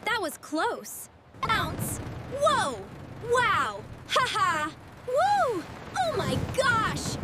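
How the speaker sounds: normal talking voice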